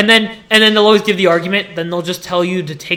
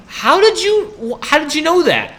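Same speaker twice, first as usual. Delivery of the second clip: high, drawn-out incredulous question; mock disbelief; 8 semitones higher, slower than usual. Student to someone who tracked their habits, mock disbelief. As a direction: ask it in exaggerated, high-pitched disbelief.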